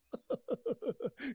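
a short self-satisfied chuckle; smug laugh. Acted. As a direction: chuckle at your own bragging. Old god laughs at own boast.

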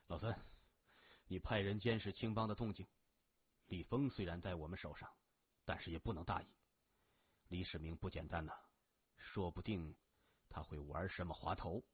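very low, hushed, cautious briefing; cool gang boss. A narrator's reading. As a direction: confide orders quietly to your deputy. Boss briefs his henchman. (压低声音，谨慎地交代)

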